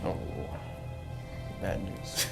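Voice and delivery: ordinary delivery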